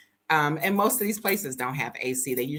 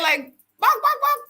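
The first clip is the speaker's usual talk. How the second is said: imitating clucking; 5 dB louder than usual. Imitating chickens.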